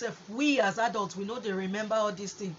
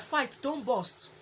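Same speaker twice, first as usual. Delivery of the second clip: usual speaking voice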